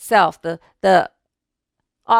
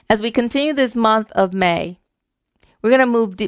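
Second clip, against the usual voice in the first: normal talking voice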